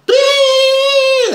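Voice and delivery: very high, loud sung beep; imitated ear ringing. Telling friends about the sound in his ear, shrill loud beep. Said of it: "Like, super loud".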